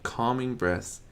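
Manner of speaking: ordinary delivery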